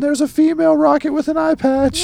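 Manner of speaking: spring it like a twist; high, excited reveal; surprised reveal. Real, not acted. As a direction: pitch jumps high on the reveal. Reveal of surprising last page.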